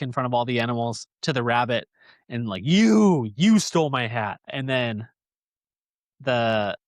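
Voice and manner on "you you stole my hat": loud sudden accusation, jumping up on the first you; accusing shout. Retold or imitated. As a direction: catch the thief red-handed, loud. Bear realizes rabbit stole hat.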